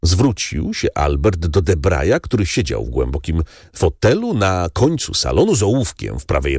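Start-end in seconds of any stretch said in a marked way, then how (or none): none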